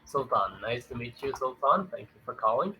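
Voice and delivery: ordinary talk, nothing marked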